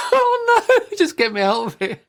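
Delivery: high, laughing "get me out"; laughing panic. Real, not acted. Parent recalls the awkward moment, laughing high. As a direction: laugh through mock despair. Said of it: "inner panic, laughing".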